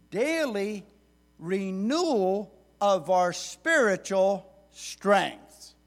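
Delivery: full preaching swell; preaching emphasis. Real, not acted. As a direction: build up and preach it.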